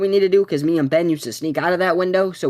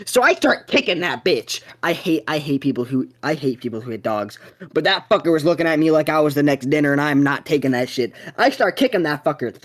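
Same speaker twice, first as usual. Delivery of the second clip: usual speaking voice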